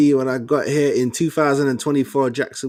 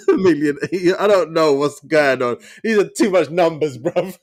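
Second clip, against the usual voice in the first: laughing; 4 semitones higher, 4 dB louder than usual. Real, not acted. Overwhelmed by contract numbers.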